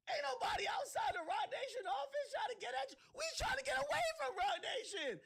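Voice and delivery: high, loud, cracking shout; real shouting rant. Real, not acted. Ranting at Desiree about Roc Nation, cracking real shout. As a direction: yell it out in disbelief, voice breaking.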